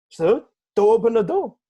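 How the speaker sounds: mock panic. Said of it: "he's like".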